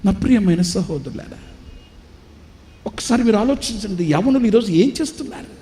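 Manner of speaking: building pulpit cry to the brothers; fervent preaching. Acted. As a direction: plead with the congregation at full force. Preacher appeals to congregation.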